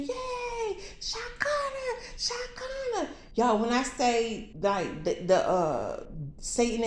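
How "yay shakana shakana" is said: high, squeaky cheering crowd voice; chirpy little cheerleader squeal; cheering angels. Retold or imitated. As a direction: cheer her name like a tiny adoring crowd.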